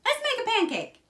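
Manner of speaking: high, loud eager cry; excited shout. A narrator's reading. Jack urges his mother to start cooking, shouting. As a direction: call it out like an eager boy. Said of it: "shouted Jack".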